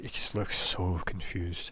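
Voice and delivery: ordinary talk, nothing marked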